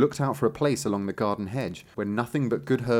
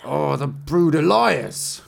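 loud, slow, raised lament; outraged cry; 5 semitones higher, 6 dB louder, much slower than usual. Acted. Tailor believes the goat over his sons, drawn-out outraged cry. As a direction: draw out the outrage at all the sons. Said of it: "cried the tailor".

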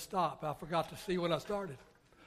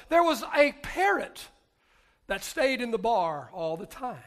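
lively storytelling; 6 semitones higher, 15 dB louder than usual. Acted. Storytelling at full volume.

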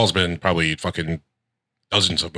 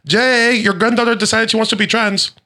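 sitcom wife impression; 13 semitones higher than usual. Wife telling grandpa family news, high sitcom wife impression. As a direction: call out, then report in a high put-on voice.

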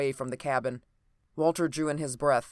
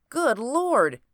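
high, loud exclamation of horror; shocked exclamation; 10 semitones higher, 5 dB louder than usual. A narrator's reading. Doctor finding a man covered in blood, shocked exclamation. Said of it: "he ejaculated".

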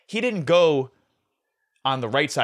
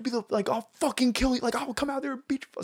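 retold yelled threat; 11 semitones higher, 4 dB quieter than usual. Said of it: "yelling at each other back and forth".

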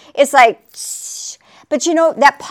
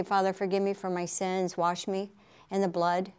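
hushed prayer; 6 semitones lower, 14 dB quieter than usual. Retold or imitated. Prayer quoted in a hush.

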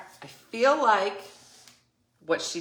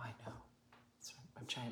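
hushed exasperated reply; 8 semitones lower, 22 dB quieter, much faster than usual. Retold or imitated. Her own exasperated reply.

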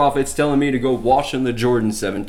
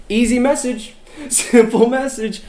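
laughing emphasis; 9 semitones higher than usual. Real, not acted. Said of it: "preacher stressing simplicity".

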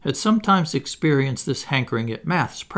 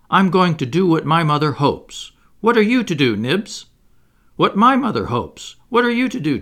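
put-on high, loud, worked-up boys' cries; hysterical cry; 7 semitones higher, 6 dB louder than usual. A narrator's reading. Boys vowing to die bravely, crying out hysterically. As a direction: cry out as if swept away. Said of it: "Toodles cried out hysterically".

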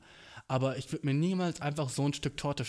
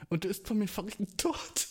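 chuckling through the words, pitch up; amused, giggly delivery; laughing; 5 semitones higher than usual. Real, not acted. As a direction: laugh while complaining about the cake. Laughing at own cake rant.

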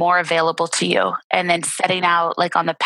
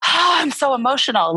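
higher, hammed-up whining; mock self-pity; 8 semitones higher than usual. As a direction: imitate the dramatic inner voice.

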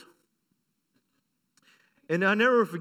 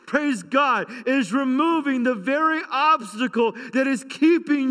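high, loud, impassioned sermon delivery; full-force preaching; 7 semitones higher, 4 dB louder than usual. Real, not acted. Pastor rousing the congregation to believe God moves.

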